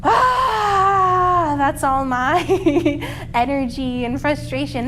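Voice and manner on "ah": high, loud ah sigh; big releasing sigh; loud release sigh. Shown as a demonstration. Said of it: "Let's sigh it out".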